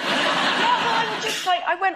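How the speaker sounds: high laughing burst. Real, not acted. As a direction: laugh through it. Laughing at own story.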